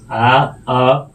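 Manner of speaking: ᱟ and ᱟᱠ stretched very slowly and said loud; slow letter demo. Shown as a demonstration. Teacher slowly sounding out ᱟ and ᱟᱠ for the class. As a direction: draw out ᱟ ... ᱟᱠ so every sound is heard. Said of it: "ᱪᱮᱫ ᱞᱮᱠᱟ ᱵᱚ ᱩᱪᱪᱟᱨᱚᱱ ᱠᱮᱫᱟ".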